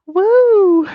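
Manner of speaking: very high, very loud whoop; excited woo. Real, not acted. Streamer cheering at the chat's comment, loud whoop. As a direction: whoop with glee.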